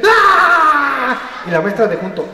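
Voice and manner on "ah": grito agudo fingido con risa; grito de angustia. Shown as a demonstration. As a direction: suelta un grito agudo de juego. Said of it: "vamos a dar un grito bien fuerte". (grito de juego de la clase, agudo)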